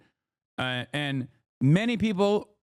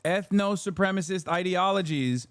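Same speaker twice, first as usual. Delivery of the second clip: ordinary delivery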